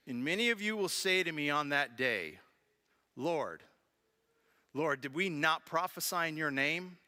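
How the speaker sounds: solemn reading of Jesus's words; scripture reading. Acted. Reads scripture aloud.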